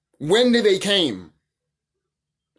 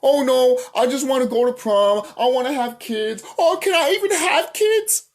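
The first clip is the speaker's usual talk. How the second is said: higher, whiny mockery of a worried teen; 6 semitones higher than usual. Mocking people who want a normal life, whiny high voice. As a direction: whine it like a fretting kid, mocking. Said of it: "people be like".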